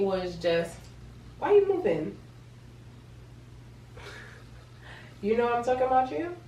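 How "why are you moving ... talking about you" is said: high-pitched cooing questions. Real, not acted. Aside to her moving unborn baby.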